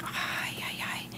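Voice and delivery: breathy unvoiced whisper, barely audible. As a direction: whisper it so he won't hear you. Sneaking away from the follower.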